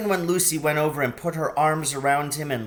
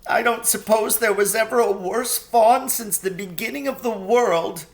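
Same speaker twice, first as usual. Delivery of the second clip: sobbing; 8 semitones higher, 7 dB louder than usual. A narrator's reading. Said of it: "between his sobs".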